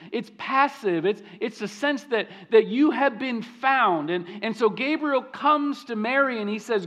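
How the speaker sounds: ordinary talk, nothing marked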